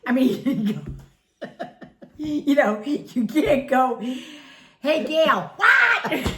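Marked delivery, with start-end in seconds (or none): 4.8-6.3 calling out her name high and loud, laughing; loud yell. Retold or imitated. Friend mock-yelling at a deaf neighbour, laughing. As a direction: yell her name like she can't hear. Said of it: "you're not even screaming".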